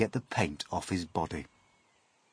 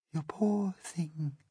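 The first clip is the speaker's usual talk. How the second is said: much softer, slow sympathy; murmured pity; 8 semitones higher, 8 dB quieter, slower than usual. A narrator's reading. Miss Spider to the centipede, soft murmur. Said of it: "she murmured".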